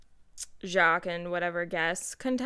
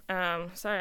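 ordinary talk

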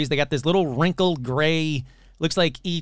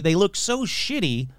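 ordinary talk, nothing marked

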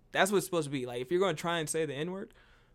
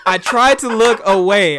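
much higher, far louder outcry; loud protest; 6 semitones higher, 18 dB louder than usual. Real, not acted. Comic defending himself to his teasing co-host, loud protest. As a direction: protest loudly over the laughter.

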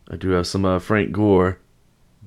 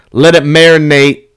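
loud quote; 5 semitones higher, 9 dB louder than usual. Retold or imitated. Quoting the locker room.

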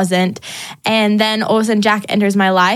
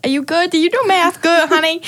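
sassy; 8 semitones higher than usual. Retold or imitated. Sassy retort to God.

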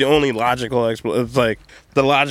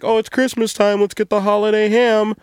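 high-pitched imitation of festive enthusiasm; mock holiday excitement; 10 semitones higher, 5 dB louder than usual. Mimicking everybody at Christmas wanting ham, high mock excitement. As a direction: gush it in a high mocking voice. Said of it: "everybody's like".

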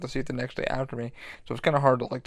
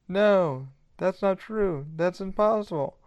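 higher, hammed-up Luke denying it; a lightly voiced Luke, pleading it isn't so; mock Luke protest; 6 semitones higher, slower than usual. Shown as a demonstration. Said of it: "I think it's Luke and Vader".

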